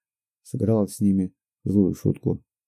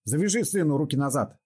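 high, forceful command; shouted order; 6 semitones higher than usual. A narrator's reading. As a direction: keep roaring at him. Robber keeps roaring orders.

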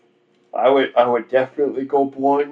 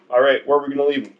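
quick resigned voice; 3 semitones higher, much faster than usual. Retold or imitated. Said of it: "He's like".

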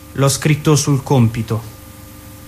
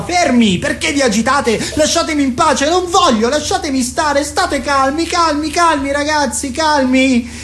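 agitated teacher; 7 semitones higher than usual. Acted. Teacher yelling at rowdy pupils.

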